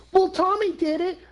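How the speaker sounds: very high mock kid voice; child making excuses. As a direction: imitate a kid blaming a friend.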